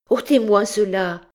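high, loud desperate cry; frantic cry. A narrator's reading. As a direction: tear the words out in terror. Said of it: "cria-t-elle avec emportement". (cri affolé, aigu et fort)

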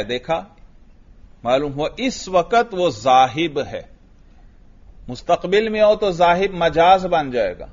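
ordinary delivery

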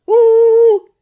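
loud high drawn-out boo; crowd booing. A narrator's reading. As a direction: boo hard at the impostors. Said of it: "поддержала толпа".

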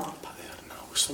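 hushed, alarmed whisper. Acted. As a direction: drop the voice to a tense whisper. Alarmed on hearing about a father.

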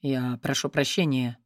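low, quiet deep man's apology; a tall man's low voice. Acted. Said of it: "Слышу сверху низкий голос".

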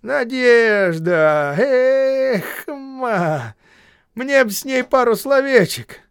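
high, loud drawn-out call; shouting. A narrator's reading. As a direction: call up out of the well. Gubin calling from well.

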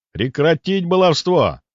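slow, high, stern order; commanding. Acted. Narrator mentally ordering Шустрик to stop, commanding. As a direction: order it firmly, drawing it out. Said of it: "мысленно велел я".

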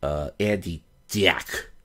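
"Andy Dick" drawn out slowly; slow, dragged "Andy Dick"; Andy Dick's way of saying his name. Retold or imitated. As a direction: stretch "Andy Dick" lazily, Andy Dick style. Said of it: "the way he pronounces words".

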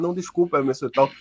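low, softened embarrassed apology; retold embarrassment. Embarrassed friend apologizing to Emerson, retold as a low, sheepish mumble. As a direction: apologize sheepishly, pitch dropped, playing it lightly. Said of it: "ele todo envergonhado".

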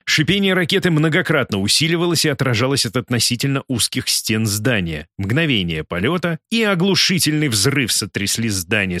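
ordinary delivery

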